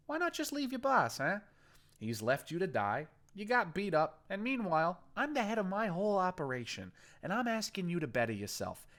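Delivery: persuasive gang boss. Acted. Boston persuading the captive to leave his boss, coaxing. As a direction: sell him on switching sides.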